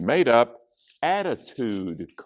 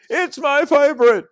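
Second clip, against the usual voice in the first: high, loud, thrilled yell; kid yelling; 9 dB louder than usual. A narrator's reading. One kid yells about the ice cream, high and loud. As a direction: shout it like an overjoyed kid. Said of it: "One yelled".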